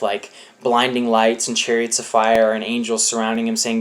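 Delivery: ordinary talk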